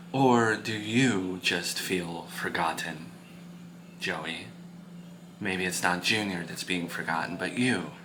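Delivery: very soft, low, slow questioning; gently probing parent. Acted. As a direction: probe gently, barely above a murmur. Parent gently probes son.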